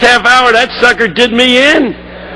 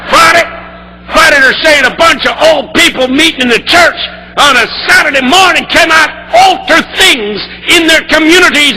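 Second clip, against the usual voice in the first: preaching at full force; 7 semitones higher than usual. Retold or imitated. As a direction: preach it to the rafters, building. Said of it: "you're getting into it".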